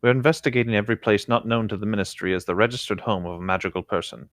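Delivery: ordinary delivery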